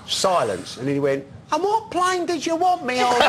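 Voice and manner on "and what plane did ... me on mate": much higher squeaky voice, laughing through it; mock him jumping at the money, high and giddy; imitating eager Bugner. Bugner accepts the deal, retold.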